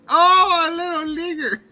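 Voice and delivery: very high, jeering mock wail; mocking echo. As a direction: jeer it back in an exaggerated high voice. Bully mockingly echoes the girl.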